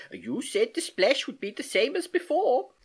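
high, teasing comeback; mischievous. A narrator's reading. Uncle turning niece's answer on her, mischievous. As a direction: throw it back at them playfully. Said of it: "he replied mischievously".

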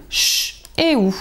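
"ch" and "ou" sounded out slowly, each as a bare sound; slow teacher demo of the sounds "ch" and "ou"; isolated phonemes. Shown as a demonstration. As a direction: sound out "ch" then "ou" like a reading teacher. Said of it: "et de deux phonèmes".

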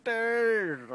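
high, long drawn-out mock call; exaggerated high wailing call; imitated calling out. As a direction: mimic a passer-by calling, stretched slowly. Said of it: "It's sort of like that".

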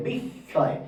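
বিস্ময় said as bish-shoy; বিস্ময় with doubled sh, m left silent; doubled sibilant. Shown as a demonstration. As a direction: stretch the sh across both syllables of বিস্ময়. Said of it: "দুবার হচ্ছে".

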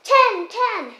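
loud, high, eager blurt; eager answer. Acted. Pupil answering teacher's easy sum, eager and loud.

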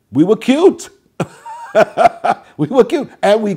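high, loud, laughing through the words; real laugh spilling into "we were cute". Real, not acted. Remembering himself as a cute child dancer, laughing loudly.

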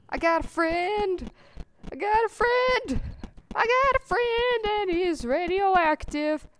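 high, loud, drawn-out cheer; gleeful celebration. Pretend. Player celebrating a new radioactive friend, in a high gleeful cheer. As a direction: crow it out high and long, overjoyed.

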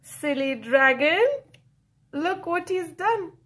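ordinary delivery

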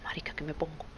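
hushed whisper. Real, not acted. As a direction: whisper it to camera. Whispering to camera.

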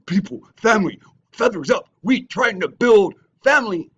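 high, loud mock rallying call; mocking his preaching. Mocking the rival rallying his followers on video, in a high, loud voice. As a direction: ham up his rallying speech. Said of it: "on the video telling you".

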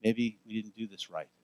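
low, hushed voice of doubt; worried doubters. Acted. Preacher voices Israelites doubting the new foundation, quietly worried. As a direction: murmur a misgiving under your breath. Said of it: "some people that started going".

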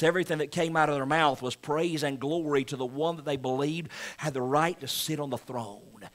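ordinary talk, nothing marked

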